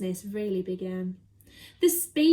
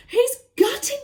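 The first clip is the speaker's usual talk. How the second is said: much higher, loud cheer; excited cry; 13 semitones higher, 9 dB louder than usual. Acted. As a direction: shriek with triumph. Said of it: "cried the monkey".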